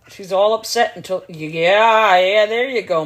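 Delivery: ordinary talk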